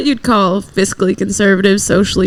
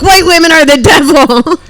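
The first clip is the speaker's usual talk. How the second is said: higher mimicked yell of a street preacher; mock street preacher; 6 semitones higher, 4 dB louder than usual. Woman mimicking street preachers to podcast hosts, mock proclamation. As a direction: imitate a preacher's raised-voice proclamation.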